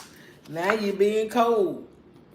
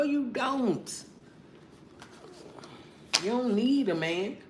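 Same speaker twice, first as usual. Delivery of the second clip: ordinary talk, nothing marked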